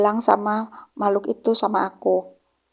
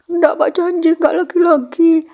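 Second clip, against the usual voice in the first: tearful promise; 8 semitones higher, 4 dB louder than usual. Retold or imitated. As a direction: promise Dad through tears. Child tearfully promising father.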